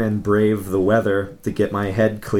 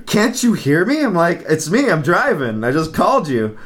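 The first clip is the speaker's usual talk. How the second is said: higher-pitched retold exasperation; exasperated insistence; 6 semitones higher than usual. Caller to a friend who cannot hear him, exasperated. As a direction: insist you're the one calling, voice pitched up.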